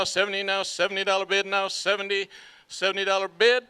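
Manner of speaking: rhythmic auction chant; auctioneer. Acted. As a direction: rattle off the bids in a steady chant.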